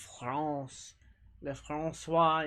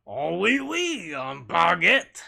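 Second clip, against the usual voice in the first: ironic French accent; 2 semitones higher, 13 dB louder, slower than usual. Shown as a demonstration. Streamer showing chat a hammed-up mock French accent. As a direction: play a cartoon Frenchman with a baguette. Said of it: "ironic French accent".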